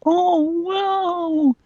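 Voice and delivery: high squeaky mock wow; fake delight. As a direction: gush in fake surprise. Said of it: "gives it to me like I was gonna be like".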